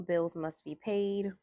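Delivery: usual speaking voice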